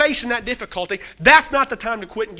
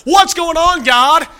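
anguished outcry; 7 semitones higher, 7 dB louder, slower than usual. Retold or imitated. David pleading with God, a loud anguished cry. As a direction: raise the voice in a desperate plea to God.

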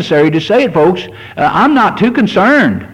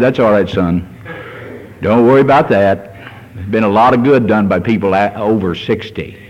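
reassuring God voice; 6 semitones lower than usual. Retold or imitated. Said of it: "God reassuring the aging preacher".

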